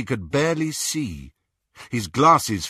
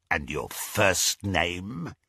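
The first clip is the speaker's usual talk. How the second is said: gloating werewolf; 4 dB quieter, slower than usual. Acted. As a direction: savour every word like prey. Greyback interrogates.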